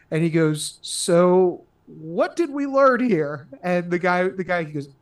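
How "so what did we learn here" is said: very slow, dazed boss's question; glazed-over director. Retold or imitated. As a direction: drag it out like a stupefied director. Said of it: "his eyes are all glazed over".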